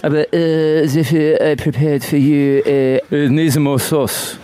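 mock French chef presenting a dish; bad French accent. Dad serving dinner to his son, bad French accent. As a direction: lay on a hammy French accent. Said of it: "replay my accent that I do for my kid".

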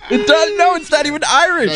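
high-pitched, laughing as talking; laughing protest. Real, not acted. Host disputing that it sounds Irish, laughing. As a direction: protest through helpless laughter. Laughing while rejecting the accent.

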